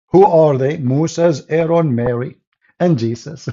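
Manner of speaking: ordinary delivery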